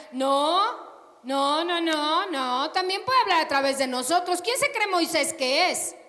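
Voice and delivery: loud indignant no, no, no; indignant Maria. Acted. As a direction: protest hotly, as Maria.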